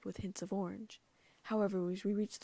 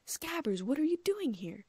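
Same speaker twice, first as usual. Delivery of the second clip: high, amazed question; can't believe your eyes; stunned disbelief; 7 semitones higher, 4 dB louder than usual. A narrator's reading. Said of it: "like he couldn't believe what he was seeing".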